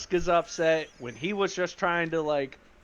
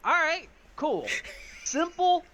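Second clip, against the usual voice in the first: rebuffed backing off; 10 semitones higher than usual. Acted. Rebuffed character backs off.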